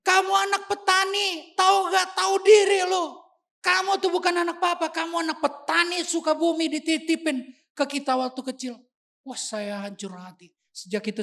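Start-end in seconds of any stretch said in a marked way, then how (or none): 0.0-8.7 much higher, loud angry shouting; angry father shouting at his son that he isn't his; furious father. Retold or imitated. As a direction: hurl it at him to shame him. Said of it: "Tiba-tiba lagi marah dia".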